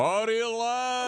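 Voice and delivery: announcer call. Pretend. Co-host mimicking Don Pardo introducing Artie, drawn-out announcer call. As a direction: boom the name like a TV announcer.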